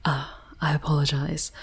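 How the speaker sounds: ordinary talk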